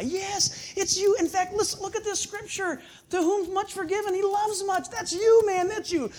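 high, urgent pleading. Retold or imitated. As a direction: beg him to believe it. Pleading with the guy.

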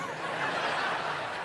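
quiet unvoiced chuckle; soft breathy laugh. Real, not acted. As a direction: laugh under the breath at the punchline. Laughs at own joke.

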